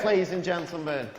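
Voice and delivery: ordinary talk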